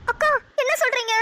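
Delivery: shocked, voice jumps up; horrified surprise, voice leaps high; shocked cry. Acted. Shocked at news Roja missing.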